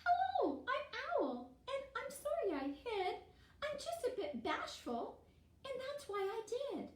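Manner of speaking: high, soft, shy owl voice; bashful Owl. Acted. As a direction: apologise timidly in a small high voice. Bashful Owl apologises for hiding.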